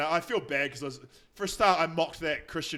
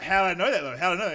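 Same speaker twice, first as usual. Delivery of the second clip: escalated; 3 semitones higher, 8 dB louder, faster than usual. Retold or imitated. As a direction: press the question hard. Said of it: "I escalated it every time".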